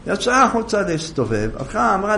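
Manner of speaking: usual speaking voice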